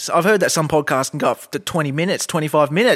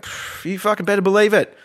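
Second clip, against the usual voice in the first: normal talking voice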